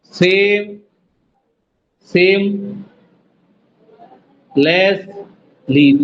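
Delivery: सेम, सेम, लेस, ली said as separate beats; syllable count. Shown as a demonstration. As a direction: tap out each syllable. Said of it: "तीन हो गए।". (शब्दांश अलग-अलग)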